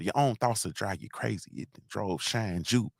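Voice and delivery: low, soft, rapper's murmur. Retold or imitated. Host quoting a rapper's line to his live audience, a soft low rapper's murmur. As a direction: lay it down quiet like a menacing rap bar.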